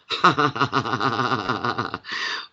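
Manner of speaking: long, rolling belly laugh; real laughter. Real, not acted. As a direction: laugh helplessly in a long run. Amused by the mix-up.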